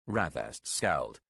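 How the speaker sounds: ordinary delivery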